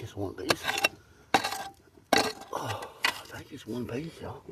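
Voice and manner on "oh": usual speaking voice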